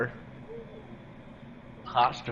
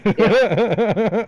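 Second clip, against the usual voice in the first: laughing; 10 semitones higher, 12 dB louder than usual. Real, not acted. Loud laughing agreement.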